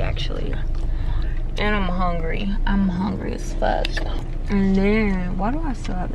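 hushed complaint, no voice at all; whispered gripe about being hungry. Real, not acted. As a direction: breathe it out under your breath. Complaining to camera while out.

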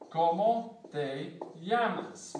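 "Como te llamas?" slow and careful, llamas like "yamas"; slow Spanish demo. Shown as a demonstration. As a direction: model the Spanish question slowly. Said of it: "means, What is your name?".

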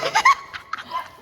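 squealing burst of laughter; high squealing laugh. Real, not acted.

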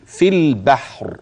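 slow measured tajweed chanting; slow Quranic recitation. Shown as a demonstration. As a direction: recite it with careful elongation. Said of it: "سمعت مني مدود زائدة".

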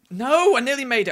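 high, loud cry of frustration; frustrated cry. Real, not acted. Gamer yelps at a jump just missed, frustrated. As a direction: yelp as the jump just fails.

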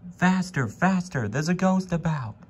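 high, loud, rushed cry; panicked wagons. A narrator's reading. Freight cars panicking at a ghost, urging speed. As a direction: urge him on in a panic.

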